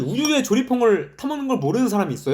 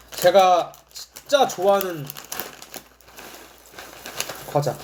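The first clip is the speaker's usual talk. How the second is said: slow savoring; slower than usual. Real, not acted. Savoring favorite snack.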